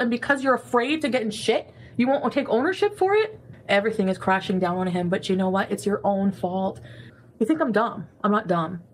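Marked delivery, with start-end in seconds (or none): none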